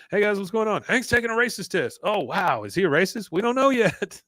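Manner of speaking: high-pitched mock chatter; imitated eager gossiping; excited onlookers. Voicing curious coworkers crowding round.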